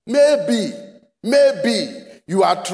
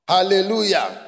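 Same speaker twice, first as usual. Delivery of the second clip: ordinary talk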